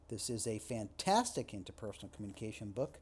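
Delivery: fantastic lifted with a rising tune; stress on FANTASTIC. Shown as a demonstration. Lecturer demoing shifted emphasis, fantastic stressed. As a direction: make fantastic the key word. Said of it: "just by shifting the emphasis".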